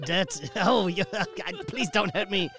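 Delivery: ordinary delivery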